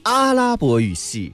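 阿拉伯语系 said slowly with each syllable weighted; 阿拉伯语系 slow and deliberate, like a teacher showing stress; stress demo. Shown as a demonstration. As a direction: lean on 阿拉伯语系 and draw it out. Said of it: "啊，要有重音。".

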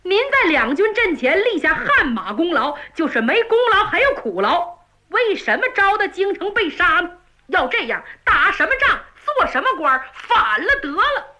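put-on a hot-headed stable boy venting; indignant servant. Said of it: "张宝说".

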